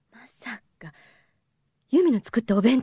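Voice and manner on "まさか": breathless whisper of dread; mother suddenly fearing the worst; horrified whisper. Acted. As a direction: breathe it as the truth dawns. Mother realizes the lunch is bad.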